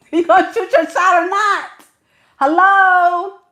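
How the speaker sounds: high-pitched, insistent outburst; exasperated demand. Acted. Woman waiting on a man to flirt, exasperated outburst. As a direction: push it high and impatient, calling out.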